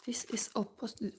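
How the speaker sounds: hushed whisper, puzzling over the cards; whispering to herself. Real, not acted. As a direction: murmur it under your breath, half to yourself. Reader puzzling over reversed cards.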